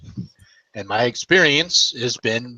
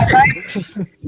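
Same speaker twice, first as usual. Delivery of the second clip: loud high laugh; 5 dB louder than usual. Real, not acted. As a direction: burst of high-pitched laughter. Laughing at the Harry Potter joke.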